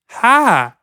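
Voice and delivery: high, loud "Ha!"; mock invented-language yelp; attempted accent imitation. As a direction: copy a co-host's silly cry, high and loud. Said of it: "I can't even do it".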